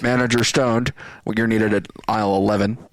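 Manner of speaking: loud mock PA announcement; store intercom page. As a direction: call out over the supermarket tannoy. Cashier pages manager over store intercom.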